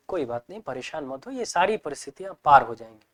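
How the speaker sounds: soft, low, reassuring father; father soothing a troubled child; consoling father. Retold or imitated. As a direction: comfort a worried son gently. Father consoling his upset son.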